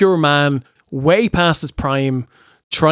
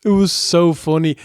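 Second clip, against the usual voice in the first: ordinary talk, nothing marked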